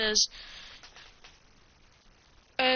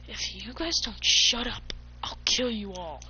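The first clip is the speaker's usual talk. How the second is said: deep menacing voice. Acted. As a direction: drop into a dark, whispery growl of a threat. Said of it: "with a deep voice".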